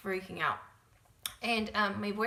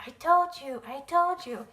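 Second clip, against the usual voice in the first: put-on triumphant boyfriend; 5 semitones higher, 9 dB louder than usual. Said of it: "And he's like".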